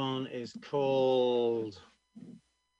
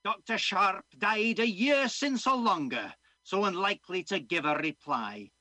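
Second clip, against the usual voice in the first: put-on loud old Scottish passerby; plain-spoken old Scot breaking the news; Scottish accent; 6 semitones higher, 7 dB louder than usual. Old man tells young doctor of Doctor Sharp, Scottish accent. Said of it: "I'm gonna be doing a Scottish accent".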